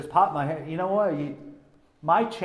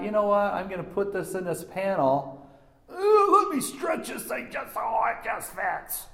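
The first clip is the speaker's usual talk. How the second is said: higher, airy voice of a lazy electrician; mock careless installer; 5 semitones higher than usual. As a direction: mimic the shortcut-taking installer. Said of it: "but they thought".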